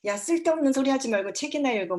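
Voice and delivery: scolding parent. Shown as a demonstration. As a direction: snap at the kid to stop and read. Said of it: "뭐 이러지 마시고".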